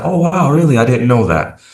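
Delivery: high, bright mock amazement; retold wide-eyed surprise; surprised. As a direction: play up the surprise at learning it. Retold surprised reaction to correction.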